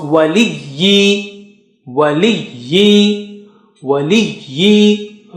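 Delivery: waliyyi with a long doubled y; teacher drilling waliyyi; shadda drilled. Shown as a demonstration. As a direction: draw out the yy in waliyyi. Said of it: "with standing kasra also".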